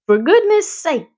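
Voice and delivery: high, loud bellow. A narrator's reading. Drenched farmer bellowing at his hired man, loud. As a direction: bellow it in dismay. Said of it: "bellowed Mister Zuckerman".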